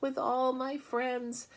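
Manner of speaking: high, tearful pleading pig voice. Acted. Wilbur sobs to Charlotte about wanting to live. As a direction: wail it through tears. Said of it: "Wilbur burst into tears".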